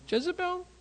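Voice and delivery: high squeaky frightened cry of a name; mock fearful squeal. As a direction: squeal the name like a scared man. Elijah's sudden fear of Jezebel.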